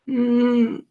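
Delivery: imitating a child's high little whimper; high-pitched mock-tearful kid; crying child. Grandma mimics her granddaughter admitting it, crying child. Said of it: "She was crying".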